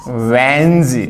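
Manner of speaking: वेंज as one syllable ending in a voiced z/j; correct pronunciation. Shown as a demonstration. Teacher gives the correct first half, one-syllable वेंज. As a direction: hold वेंज and buzz the final consonant. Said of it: "सही उच्चारण क्या होगा?".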